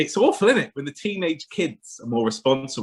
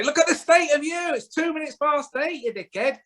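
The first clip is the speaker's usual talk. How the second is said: high, shrill-free mock mum tirade; imitating an angry mum, much higher; scolding mum; 13 semitones higher than usual. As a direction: tell the kid off like an eighties mum. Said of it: "She'd say".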